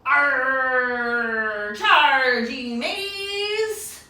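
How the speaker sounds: loud drawn-out pirate roar; pirate cry. Acted. Teacher roars as a pirate for the kids, loud. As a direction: bellow like a pirate charging the boat. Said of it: "what does the pirate say".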